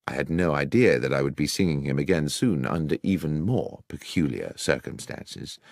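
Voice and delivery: ordinary delivery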